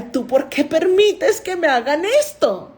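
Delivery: voz de perrita lenta, un poco aguda y dolida; voz de la perra ofendida. Pretend. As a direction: haz hablar a la perra con reproche, despacio. (la dueña imagina a su perra reprochándole, voz lenta y dolida)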